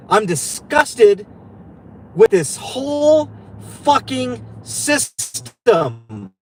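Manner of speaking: slow, word-by-word exasperation; real disgust. Real, not acted. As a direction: drag out each word in disgust.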